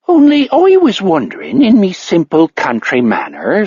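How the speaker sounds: high, lilting mock Irish country girl; coy Irish brogue, playing simple; hammed-up stage Irish accent. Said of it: "dropping the stage Irish accent".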